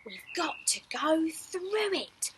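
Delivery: usual speaking voice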